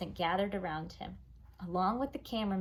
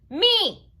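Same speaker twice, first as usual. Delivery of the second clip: put-on much higher, loud little-kid demand; demanding toddler; 12 semitones higher, 13 dB louder than usual. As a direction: insist like a toddler.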